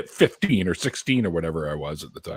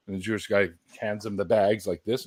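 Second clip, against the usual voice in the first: ordinary delivery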